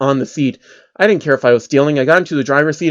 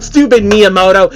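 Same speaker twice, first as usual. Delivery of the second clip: louder, higher whiny complaint then a laugh; mock outburst; 6 semitones higher, 5 dB louder than usual. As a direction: blurt it like a sore loser. Character outburst at game.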